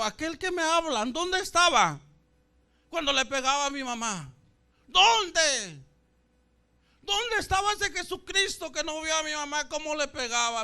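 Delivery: high, loud accusing questions; anguished challenge. Retold or imitated. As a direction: demand an answer from the preachers, re-enacted. Klagt die Evangelisten an.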